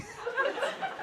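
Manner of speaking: high squeak of a laugh; a sudden high giggle breaking in. Real, not acted. Laughing while telling the sandwich story.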